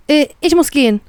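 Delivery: high-pitched, stammered excuse; hurried excuse. A narrator's reading. Captive trying to get up and leave, flustered. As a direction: stumble out a nervous excuse. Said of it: "sage ich schnell".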